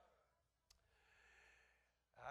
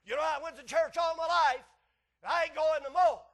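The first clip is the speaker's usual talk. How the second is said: loud, high-pitched defiant outburst; defiant protest; 10 semitones higher, 17 dB louder than usual. Acted. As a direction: shout it like a fed-up churchgoer. Acts out defiant ex-churchgoer.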